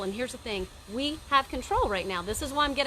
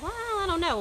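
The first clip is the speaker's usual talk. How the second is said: mock shrug; 10 semitones higher than usual. Mimicking people who hesitate.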